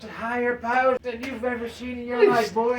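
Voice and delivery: high, loud laughing brag; a cocky leprechaun lording it over the old men; boasting leprechaun. Acted. Leprechaun boasting to the bowling team, high and loud.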